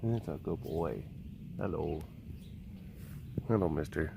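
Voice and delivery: soft, low reassuring voice; soft soothing. Real, not acted. Soothing the steer after he was sick, softly. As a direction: soothe him gently, quiet and low.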